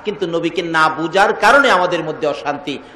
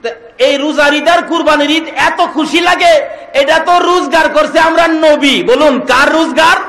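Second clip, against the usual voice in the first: raised, high, impassioned preaching; full-force preaching; 8 semitones higher, 5 dB louder than usual. Acted. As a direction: drive the point home loudly to the crowd.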